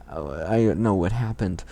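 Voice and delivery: ordinary talk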